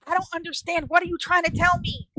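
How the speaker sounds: high-pitched, frustrated questioning; baffled exasperation. Retold or imitated. Teen asking the pointing neighbor what she means, baffled. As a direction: call up pleadingly for an explanation.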